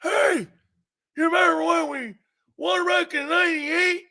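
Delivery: put-on high, slurred old-timer's brag; toothless Cowboy fan. Cowboy guy boasts about old wins, high toothless mumble. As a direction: mumble it loosely as if the false teeth are out. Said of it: "put your false teeth in".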